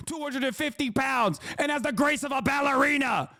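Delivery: high, whining mock outrage; mocking crybaby complainer. Streamer imitating people complaining about athletes, mocking wail. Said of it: "That's what you sound like".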